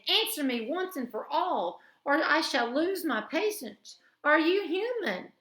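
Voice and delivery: rising, loud impatient demand; impatient queen. A narrator's reading. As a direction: lose your patience with him. Queen losing patience.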